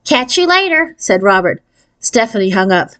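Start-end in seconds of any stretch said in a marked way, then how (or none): 0.0-0.9 high squeaky sign-off, pleased with himself; lightly voiced nerdy boy saying goodbye; squeaky nerd. A narrator's reading. Boy ending the phone call, high and squeaky. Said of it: "He has a really squeaky voice".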